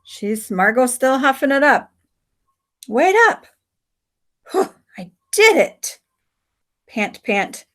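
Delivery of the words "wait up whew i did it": put-on high, bright-pitched young girl calling; girl's voice. Margot calls back to Samson, high girl's voice.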